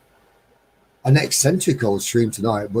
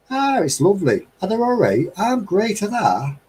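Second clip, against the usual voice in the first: higher, chatty broad Midlands reply; Midlands dialect; 10 semitones higher than usual. Shown as a demonstration. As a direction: answer like a cheerful local. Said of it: "talk like where I come from".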